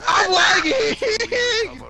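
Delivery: high, very slow wailing yell; drawn-out wail. Real, not acted. Player whose game is lagging, long wailing yell. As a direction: stretch the complaint into a long high howl.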